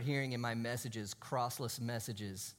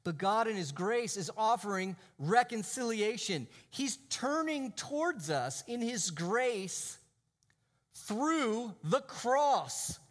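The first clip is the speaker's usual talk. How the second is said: raised, forceful sermon delivery with drawn-out key phrases; emphatic preaching; 6 semitones higher, 4 dB louder than usual. Real, not acted. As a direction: preach it with conviction, punching the key words.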